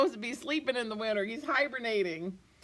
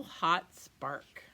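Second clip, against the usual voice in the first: ordinary delivery, nothing marked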